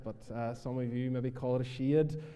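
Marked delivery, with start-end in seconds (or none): 1.7-2.2 "shade" said with a long ee-like vowel, County Antrim style; shed as shade. Shown as a demonstration. Minister showing how church members say shed. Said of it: "a different accent".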